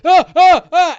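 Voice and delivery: short high, loud attack shouts; three sharp bursts of "ah"; combat yells. Acted. Game master acts out the player's attack, loud yells. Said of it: "you go".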